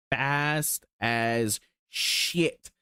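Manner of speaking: fast as shit, each word stretched very slow; drawn-out emphasis. Real, not acted. Hyped about the little Dallara, drawing out every word very slowly.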